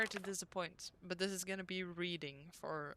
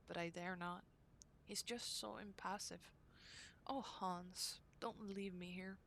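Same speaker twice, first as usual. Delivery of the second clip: diary reading; 5 dB quieter than usual. Acted. Reads diary entry from game.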